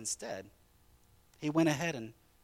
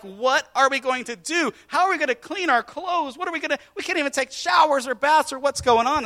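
high, loud frantic questions; panicked questions; 12 semitones higher, 9 dB louder than usual. Retold or imitated. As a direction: retell the panic of being stranded. Panicked campers retold.

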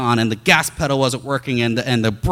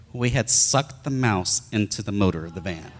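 usual speaking voice

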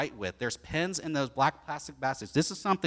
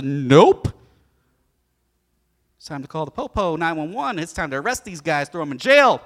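harsh refusal; 12 dB louder than usual. Acted. Refuses and calls police.

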